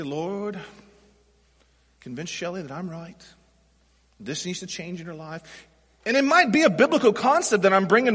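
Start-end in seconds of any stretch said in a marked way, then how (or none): 0.0-5.4 much softer, half-murmured prayer to the Lord; a hushed, mock-pious little prayer. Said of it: "I pray for her".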